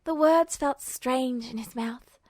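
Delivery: ordinary delivery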